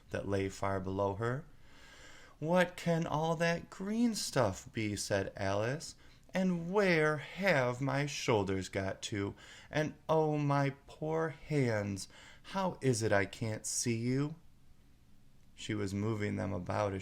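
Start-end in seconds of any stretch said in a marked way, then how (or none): 2.4-5.0 Alice puzzled. Acted. 6.3-14.4 Alice dismayed. Acted.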